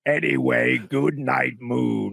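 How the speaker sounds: lightly voiced demon audiobook narrator; Pinhead. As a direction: read a bedtime title as a horror villain. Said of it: "Pinhead from Hellraiser".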